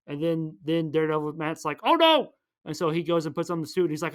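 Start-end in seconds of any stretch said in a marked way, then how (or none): 1.8-2.3 high, loud mock alarm. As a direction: blurt out a hammed-up alarm. Matt hears Elektra is threatened.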